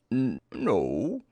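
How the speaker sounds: feigned carelessness with a small stammer; hesitant, offhand denial; careless. A narrator's reading. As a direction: brush it off in a bear's character voice. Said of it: "said Pooh carelessly".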